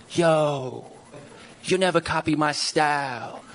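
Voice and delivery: slow, lightly voiced rapper boast; mock rapper. Comic mocking lazy rap lyrics, slow boast. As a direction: drawl it like a rapper punchline. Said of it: "they'll say something like".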